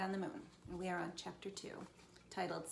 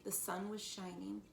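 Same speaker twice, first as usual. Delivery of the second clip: ordinary delivery, nothing marked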